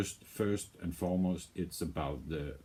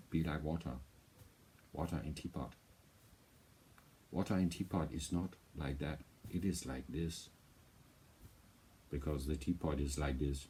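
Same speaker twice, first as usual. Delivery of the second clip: a lightly voiced, softer Bruce Lee quote; half-hearted Bruce Lee wisdom voice; a hint of Bruce Lee, not a good one; bad Bruce Lee impersonation; 3 semitones lower, 6 dB quieter than usual. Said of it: "It's not even a good Bruce Lee voice".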